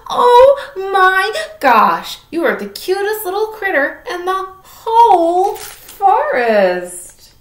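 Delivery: high, loud and slow gushing over a cute animal; gushing girl. Acted. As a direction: squeal with delight at the cutest critter. Lucy adores the critter.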